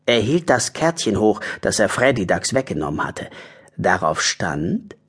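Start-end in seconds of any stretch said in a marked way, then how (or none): none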